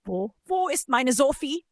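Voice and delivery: desperate plea, pitch climbing on the questions; halting, rising, anguished questions; suddenly human, desperate. A narrator's reading. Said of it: "plötzlich menschlich und verzweifelt".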